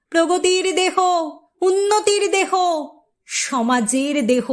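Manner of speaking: usual speaking voice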